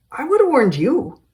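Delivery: loud high taunting yell; mocking retort. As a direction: throw it back in his face.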